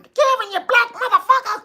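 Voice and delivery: high screeching mock old aunt; aunt yelling. Mean aunt yelling at young Kevin, high mock screech. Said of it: "She used to call me".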